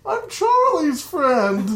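high, slow timid reply; meekly explaining himself; nervous friend. Retold or imitated. Friend caught by the uncle, timid high reply. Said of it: "I hear Foss go".

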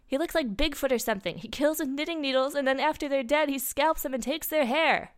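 high-pitched worried character; frightened account. A narrator's reading. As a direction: relay it in acted alarm. Frightened character describing the killer.

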